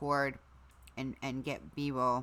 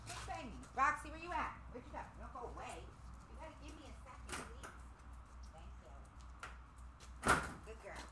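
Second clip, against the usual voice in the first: much higher, much softer coaxing pet talk; talking to the dogs; 8 semitones higher, 11 dB quieter, much faster than usual. Real, not acted. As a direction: coo softly up high to the dog at the door.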